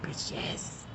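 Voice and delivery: unvoiced whispered confirmation. [name] whispering back about the smell. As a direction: breathe it back in secret.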